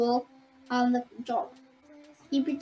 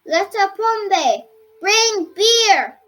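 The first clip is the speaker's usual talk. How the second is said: loud, higher, slow shouted order; 5 semitones higher, 8 dB louder, much slower than usual. A narrator's reading. Dola shouts for beer to celebrate, loud. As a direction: bellow for beer across the room. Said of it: "shouted Dola".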